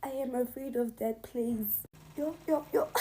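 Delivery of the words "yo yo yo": high frightened yelp; real frightened cry. Real, not acted. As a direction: cry out in real fear of the graveyard. Afraid of the graveyard.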